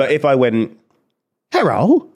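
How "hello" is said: high-pitched, hammed-up Chinese accent; mock Chinese accent. Shown as a demonstration. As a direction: briefly caricature a Chinese accent. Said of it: "a Chinese pe-, person go".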